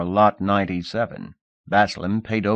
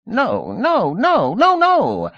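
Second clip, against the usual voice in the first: high, louder scream of no; 14 semitones higher, 5 dB louder, slower than usual. Acted. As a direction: cry out no, much higher each time. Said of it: "Baslam screamed".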